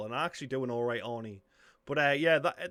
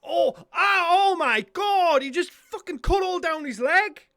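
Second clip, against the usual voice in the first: real yell, much higher and louder; shocked shout; 9 dB louder than usual. Real, not acted. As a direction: scream out in genuine disbelief. Shocked reaction to brutal scene.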